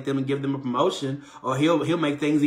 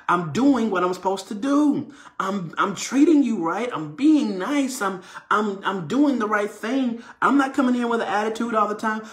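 higher, pleading complaint; frustrated whining; 6 semitones higher than usual. Retold or imitated. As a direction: whine like an unappreciated worker, higher up. Frustrated worker protesting.

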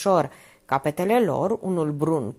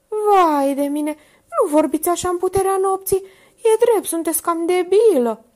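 put-on higher louder dismayed old maid; aghast maid; 13 semitones higher, 7 dB louder than usual. A narrator's reading. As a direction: scold her in horror. Minerva aghast at talk of death.

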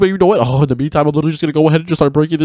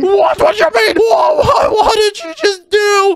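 shocked shout; 12 semitones higher, slower than usual. Acted. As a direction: yelp in total shock. Shocked as mom jumps off.

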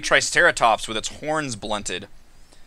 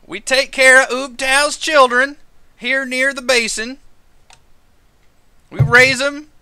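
high, folksy country rancher; high-pitched country storyteller; lizard rancher; 10 semitones higher, 5 dB louder than usual. Acted. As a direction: explain it proudly in a high rancher voice. Lizard rancher explains ranch life.

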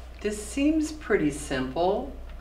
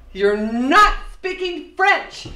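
slow, loud, higher frustrated yell; exasperated outburst; 7 semitones higher, 6 dB louder, slower than usual. Acted. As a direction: finally explode at the student. Frustrated teacher exploding.

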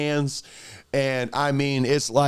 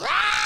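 very high, long mock scream; fleeing scream. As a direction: shriek like a demon driven out. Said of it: "that man goes".

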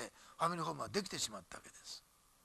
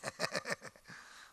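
breathy laugh; 6 dB quieter than usual. Real, not acted. Laughs after joke.

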